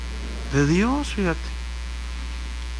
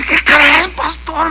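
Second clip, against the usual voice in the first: high-pitched tearful imitation; imitated crying; 9 semitones higher, much slower than usual. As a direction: act out someone breaking the news in tears. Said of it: "llorando me dice". (imitando llanto, con voz aguda)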